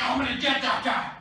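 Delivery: an indignant threat, hammed up; acted fury. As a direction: play a fisherman losing his temper. Imagined Peter angry at the stranger.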